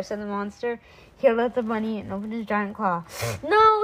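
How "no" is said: high, loud cry of protest; Dottie's shout. A narrator's reading. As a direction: shout to stop the monster. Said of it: "shouted Dottie".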